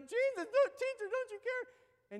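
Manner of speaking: high, loud desperate plea; panicked cry. Retold or imitated. Disciples in the storm plead with Jesus, high and frantic. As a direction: cry out to him in terror.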